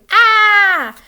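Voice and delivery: high, loud scream "Ah!". A narrator's reading. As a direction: scream as you flee. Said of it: "screamed too".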